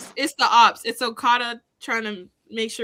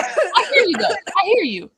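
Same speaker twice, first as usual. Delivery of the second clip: laughing; 8 semitones higher, 6 dB louder than usual. Real, not acted. Laughing reply.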